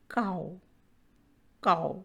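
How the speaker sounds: COW said low and alone, vowel gliding to oo; diphthong demonstration. Shown as a demonstration. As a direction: model "cow" as one falling, gliding syllable. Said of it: "and moves towards another". (cow को धीमे सुर में, स्वर मिलाकर बोलें)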